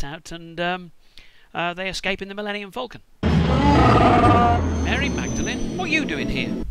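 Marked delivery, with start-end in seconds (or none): 3.2-6.6 slow, loud drawn-out cry; mock surprise. Hero spotting Mary Magdalene, surprised. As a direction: call out like a shocked movie hero. Film-style surprised exclamation.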